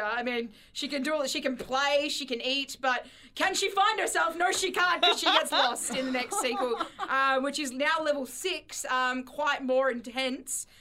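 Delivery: usual speaking voice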